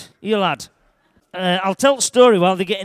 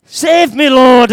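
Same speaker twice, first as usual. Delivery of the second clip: desperate yell, higher and much louder; 6 semitones higher, 11 dB louder, much slower than usual. Acted. Sinking Peter yelling to Jesus for rescue, desperate and loud. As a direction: scream for help while sinking. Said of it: "I yelled at top of my voice".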